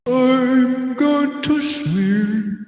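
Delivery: put-on slow, lightly sung old grandpa; drawn-out sleepy grandpa voice. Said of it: "like, a grandpa voice".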